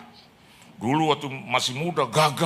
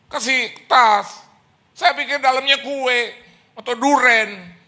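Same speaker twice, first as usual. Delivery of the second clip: preaching loudly; 8 semitones higher, 5 dB louder than usual. Acted. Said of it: "preaching at full force".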